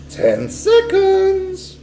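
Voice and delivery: very high, very slow witch count; drawn-out witch cry. A narrator's reading. Witch counting down to the boy, high and drawn out. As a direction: stretch the count out gleefully. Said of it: "cried the Grand High Witch".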